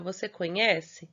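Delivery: ordinary delivery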